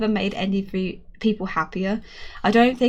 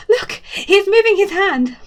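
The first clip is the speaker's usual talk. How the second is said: high, loud joyful cry; loud tearful cry of joy; excited cry; 11 semitones higher, 7 dB louder than usual. A narrator's reading. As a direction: burst out, overjoyed and near tears. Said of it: "that's when they go bananas".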